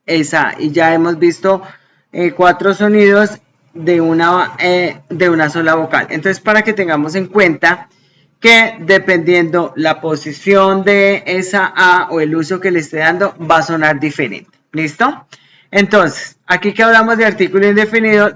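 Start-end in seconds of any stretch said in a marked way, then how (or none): none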